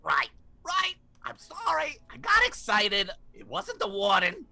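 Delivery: high sheepish parrot; squawky apology. Acted.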